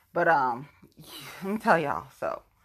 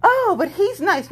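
mocking others; 12 semitones higher, 4 dB louder than usual. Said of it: "It's like".

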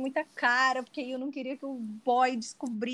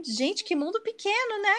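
feigned surprise; 8 semitones higher than usual. Retold or imitated. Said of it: "eu só fingi demência".

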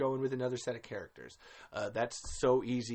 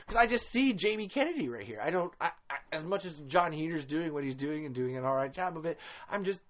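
normal talking voice

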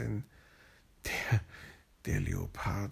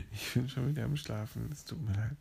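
ordinary delivery, nothing marked

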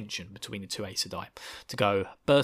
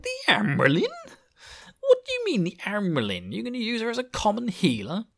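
indignant Aes Sedai; 11 semitones higher, 4 dB louder than usual. Retold or imitated. Landrin voiced, outraged disbelief.

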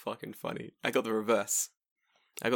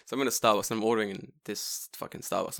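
ordinary talk

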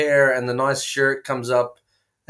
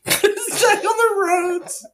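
very high, slow falsetto parody of an announcer; laughing, squeaky mock announcer; mocking echo. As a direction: squeal it back as a silly traffic reporter. Mimics co-host's traffic report opening.

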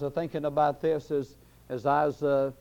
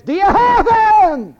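drawn-out high devil's yell; devil crying out; 13 dB louder, slower than usual. Acted. Devil calling up to the world on the third morning, crying out. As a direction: holler it slowly at full voice. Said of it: "The devil cries, calls up to the world".

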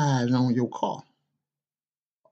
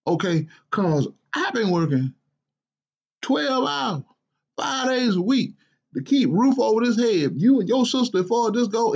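a grumpy father's higher, exasperated voice; scolding dad; 9 semitones higher than usual. Acted. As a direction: scold your lazy grown son, incredulous. Father lecturing his jobless son.

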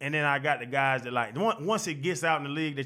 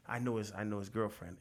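hushed tip-off; 7 semitones lower, 9 dB quieter than usual. Retold or imitated. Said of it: "He be like".

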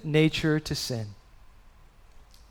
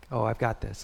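smug dismissive tone; 6 semitones lower, 8 dB quieter than usual. Retold or imitated. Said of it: "We know that tone".

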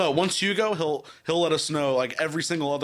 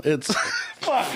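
ordinary talk

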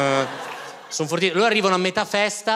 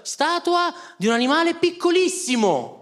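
chirpy announcement; 9 semitones higher than usual. Retold or imitated. Entertainer announcing to kids.

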